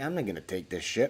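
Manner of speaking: a thought said under the breath; inner voice, fed up. Retold or imitated. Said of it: "And I'm like, in my head".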